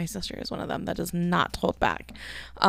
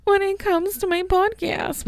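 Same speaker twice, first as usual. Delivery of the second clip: high insecure whine; 13 semitones higher than usual. Real, not acted. Admitting podcast insecurity in a high whiny voice. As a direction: fret in a tiny high voice. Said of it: "I'm a little insecure".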